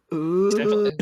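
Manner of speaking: loud, high zombie growl; zombie noise. Pretend. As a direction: growl like a hungry corpse. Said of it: "what's your best zombie noises".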